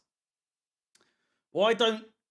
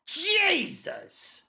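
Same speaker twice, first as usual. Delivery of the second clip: sudden exclamation, pitched far higher; shocked. Real, not acted. Streamer reacting to the keeper's age, sharply surprised. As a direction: blurt it out in real surprise.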